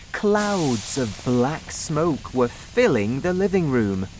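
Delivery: usual speaking voice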